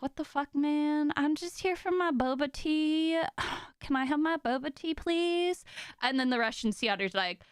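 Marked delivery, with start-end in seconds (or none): 0.0-5.6 higher-pitched, put-upon otter voice; southern otter. Acted. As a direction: voice an otter pleading for its order. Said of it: "the southern, uh, sea otter's like".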